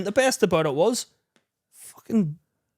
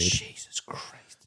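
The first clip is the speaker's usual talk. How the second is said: whisper; 11 dB quieter, much slower than usual. Real, not acted. Dread recalling Big Helga.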